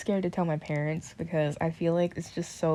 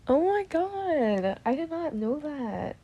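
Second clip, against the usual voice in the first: slow, high, drawn-out oh my God; the penny drops mid-sentence, slowly; stunned realization; 9 semitones higher, slower than usual. Real, not acted. Realizing her own truth while talking to viewers, stunned and slow.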